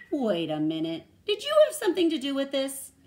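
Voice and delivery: loud, rising to a high question; storybook mama's surprised query; dawning suspicion. A narrator's reading. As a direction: question her as realization hits. Mama questions her grinning daughter.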